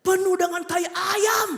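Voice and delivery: high, slow, full-voiced shout; loud mock-preacher cry, drawn out; pastor's shouted answer. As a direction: proclaim it like a pulpit climax. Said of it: "pas itu pendeta khotbah".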